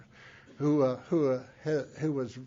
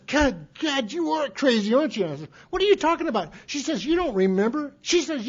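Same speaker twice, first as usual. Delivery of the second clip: higher-pitched retold sister, incredulous; amused sister; 7 semitones higher, 4 dB louder than usual. Speaker voices his sister mocking his forgotten drinking years, higher and incredulous. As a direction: voice the sister teasing him, pitched up.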